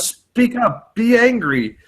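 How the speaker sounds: high-pitched, pleading imitation; mock exasperation. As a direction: urge it like a baffled, frustrated listener. Acts out exasperated listener demanding anger.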